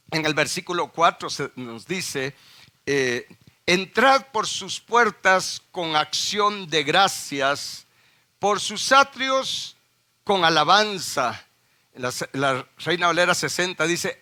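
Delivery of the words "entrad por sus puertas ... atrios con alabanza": slow, lifted reading of the verse; slow solemn reading of the psalm verse; solemn scripture reading. Acted. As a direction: read it out slowly, like scripture.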